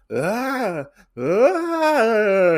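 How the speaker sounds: high, loud imitated scream from afar; distant scream. Retelling a comic scene, a loud high scream from afar. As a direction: wail it out like someone far off.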